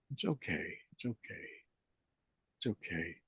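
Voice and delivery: very soft, soothing repeated reassurance; soothing self-talk. Shown as a demonstration. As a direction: whisper it gently to yourself, again and again. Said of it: "then it can lead into".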